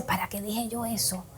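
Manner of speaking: whispered to oneself in regret; regretful whisper. Retold or imitated. Person after an argument whispering in regret. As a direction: breathe it out, ashamed of what was said.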